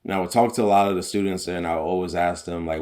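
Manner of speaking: normal talking voice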